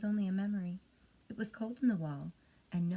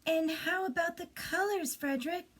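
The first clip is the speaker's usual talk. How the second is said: much higher, pleading little mice; high mouse voice; 9 semitones higher than usual. Acted. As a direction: ask up high like hungry mice. Mice asking anxiously.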